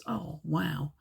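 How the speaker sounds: ordinary delivery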